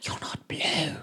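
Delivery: hushed, gasped whisper; astonished whisper. Acted. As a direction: whisper it in shock after a gasp. Turns round, gasps at the change.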